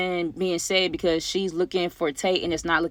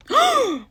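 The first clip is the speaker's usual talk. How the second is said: loud, high intake of breath; dramatic mock gasp; shocked gasp; 4 dB louder than usual. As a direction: gasp as if hit by a revelation. Reacting to the pregnancy reveal.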